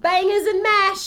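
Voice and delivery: high, loud hammed-up British accent; mock British accent. Teasing UK listeners, a hammed-up British accent. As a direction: sing out British food in a silly accent. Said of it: "We nailed that accent".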